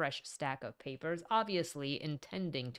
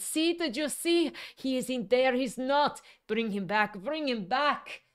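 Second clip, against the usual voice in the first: shrill high frantic scream; 8 semitones higher, 5 dB louder than usual. A narrator's reading. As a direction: scream in hysterical panic. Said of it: "and screamed".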